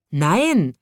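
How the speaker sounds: loud angry shout. A narrator's reading. Snub-nosed boy shouts at the others, angry and loud. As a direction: shout the refusal like a cross boy. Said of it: "schrie ein stupsnäsiger Junge zornig".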